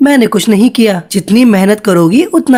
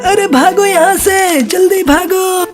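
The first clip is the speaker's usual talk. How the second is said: much higher, slow panicked call; panicked alarm; 9 semitones higher, slower than usual. Acted. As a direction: urge everyone out of the fire. Fire breaks out at party.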